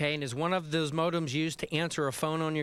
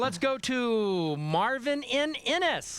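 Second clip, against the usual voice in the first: higher, drawn-out announcer call; pumped-up host; 8 semitones higher, slower than usual. Pretend. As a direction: introduce the caller like a hyped game-show announcer. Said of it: "we'll pick up the pace".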